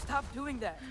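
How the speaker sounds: high, startled scolding; startled protest. Acted. Boy tells off visitor who startled him, startled protest.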